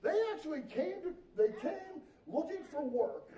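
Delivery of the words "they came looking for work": usual speaking voice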